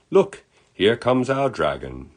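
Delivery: high, loud, slow British announcement; drawn-out mock-British call; terrible British accent. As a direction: herald an arrival in a put-on British accent. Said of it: "I do a terrible British accent".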